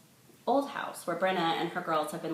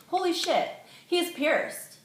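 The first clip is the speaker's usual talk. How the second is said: stunned; 12 semitones higher than usual. A narrator's reading. Narrator stunned by the news.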